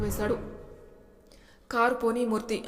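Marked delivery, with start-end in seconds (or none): none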